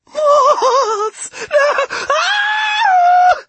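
very high, loud shrieks; terrified scream. A narrator's reading. Dark Lord attacked by moths, screaming in terror. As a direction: scream in mounting panic. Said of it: "he screamed".

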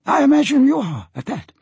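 captain character. Acted. Captain agrees with diplomat, high character voice.

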